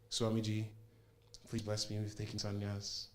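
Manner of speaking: softly spoken, humble prayer request; hushed plea. Retold or imitated. Devotee asking Swamiji for a blessing, hushed plea.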